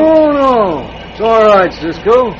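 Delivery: hasty reassurance. Acted. Villain hastily waving Cisco inside.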